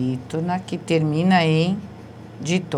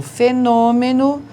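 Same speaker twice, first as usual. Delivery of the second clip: Fenômeno repeated slowly and a bit higher; 5 semitones higher, slower than usual. Shown as a demonstration. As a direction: say Fenômeno again, slowly and clearly. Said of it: "veja, é uma proparoxítona". (Fenômeno repetido devagar)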